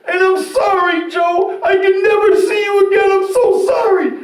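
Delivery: high, loud, desperate pleading; frantic shouted apology. Retold or imitated. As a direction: yell the promise like she's begging. Girlfriend shouting goodbye.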